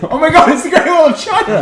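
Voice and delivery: high, laughing exclamation of mock awe; mock amazement. Friend hammering a joke about a photo, laughing mock amazement. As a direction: gasp it out like a grand discovery.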